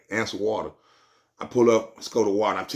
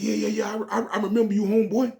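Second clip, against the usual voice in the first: higher, friendly clerk on the phone; voicing the clerk; 11 semitones higher than usual. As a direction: pitch up, recognize him warmly.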